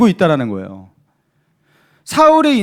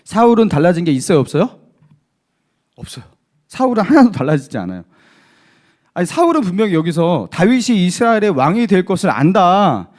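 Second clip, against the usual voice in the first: ordinary talk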